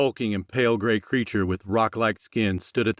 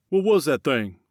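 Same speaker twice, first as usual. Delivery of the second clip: alarmed question, pitched up; scared; 5 semitones higher than usual. Acted. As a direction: ask it with rising fear. Wilson voiced, scared.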